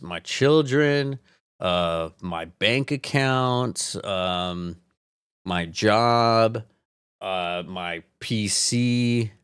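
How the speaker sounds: slow, sarcastic reluctant listing; slow deadpan list. Pretend. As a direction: list them like it's a chore. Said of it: "slow reluctant list".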